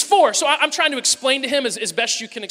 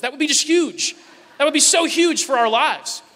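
mock excitement; 4 semitones higher, 5 dB louder than usual. Real, not acted. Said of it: "joking about huge breakthrough".

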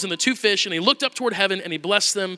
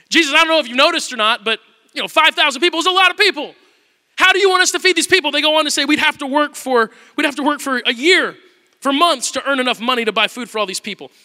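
higher, louder exasperation; exasperated protest; 6 semitones higher, 6 dB louder than usual. Retold or imitated. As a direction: protest the impossible task. Disciples protest to Jesus.

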